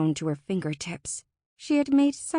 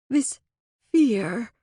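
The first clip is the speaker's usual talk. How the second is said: halting, shuddered words; shuddering; 4 semitones higher, 5 dB louder than usual. A narrator's reading. Fae names what controls them now, shuddering. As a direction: break the phrase with a shudder of dread. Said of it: "he shuddered".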